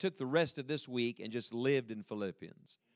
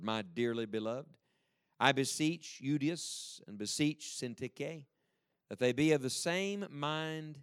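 scripture reading; 3 semitones lower than usual. Acted. Reads scripture aloud slowly.

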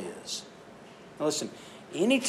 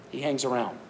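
normal talking voice

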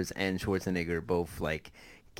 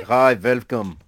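loud, mock Austrian accent; Schwarzenegger accent; 2 semitones higher, 11 dB louder, slower than usual. Podcaster mocking the actor's fake American name, thick Austrian accent. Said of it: "such a thick Austrian accent".

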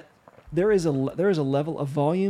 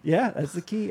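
ordinary talk, nothing marked

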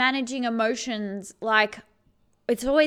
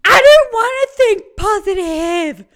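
hammed-up angry shout, much louder and higher; dramatized outburst; 8 semitones higher, 12 dB louder than usual. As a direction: throw it back at the friend's advice like a tantrum. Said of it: "I'm just trying to dramatize this".

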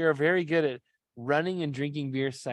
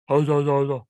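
Putty; 2 semitones higher, 6 dB louder than usual. Acted. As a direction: imitate his booming, down-in-tone delivery. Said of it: "he's way more like".

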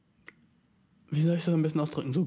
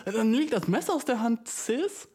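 high teasing; 6 semitones higher than usual. Retold or imitated. Teasing retort to girlfriend.

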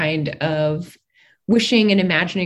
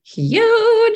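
excited; 7 dB louder than usual. Real, not acted. Hopeful about the bill.

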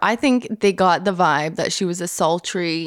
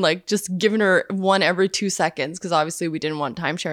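ordinary talk

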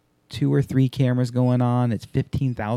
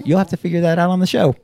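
fast, higher cheeky reply; 7 semitones higher, 4 dB louder, faster than usual. Retold or imitated. Answering the guest questionnaire, cheeky and fast. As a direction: toss it off cheekily. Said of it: "I did answer".

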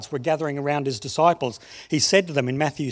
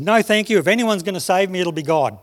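stubborn shout back; 6 dB louder than usual. Acted. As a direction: yell it up at the helicopter, refusing. Stubborn man refuses helicopter.

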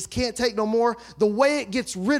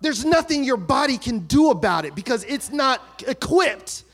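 loud, rising preacher's call with shouts; full-force preaching; 3 semitones higher, 4 dB louder than usual. Acted. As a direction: preach it out to the whole church.

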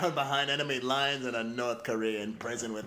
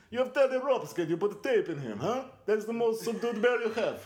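higher laughing Russian strongman taunt; mock a rival like a brute; barbarian; 10 semitones higher, 5 dB louder than usual. Putin taunt bit.

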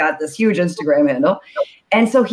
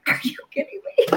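high, whispered mock amazement; stunned disbelief; 10 semitones higher, 5 dB quieter, faster than usual. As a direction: breathe it out, floored by the gift. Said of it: "And I'm just like".

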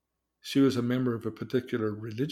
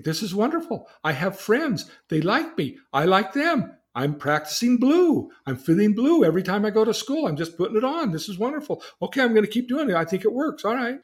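excited; 8 semitones higher, 5 dB louder than usual. Retold or imitated. Girl thrilled with new friends.

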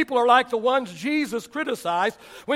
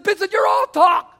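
loud, higher mock coach shout of "all talk"; coach yelling; 7 semitones higher, 5 dB louder than usual. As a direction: yell it in the player's face. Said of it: "got in my face and says".

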